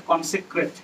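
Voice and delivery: কনসিক্রেট said clearly as kon-si-kret; careful pronunciation. Shown as a demonstration. Teacher modelling how to say কনসিক্রেট. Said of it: "উচ্চারণ ঠিকভাবে করবে".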